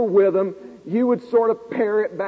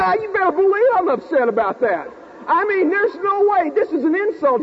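heated outburst; 9 semitones higher than usual. Retold or imitated. Student losing it with the professor, heated and high. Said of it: "I said".

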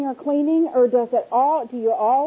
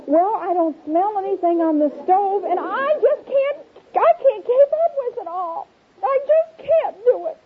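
much higher, desperate near-tears; overwhelmed wail; 7 semitones higher than usual. Pretend. Wife wailing to husband that she can't keep up, near tears.

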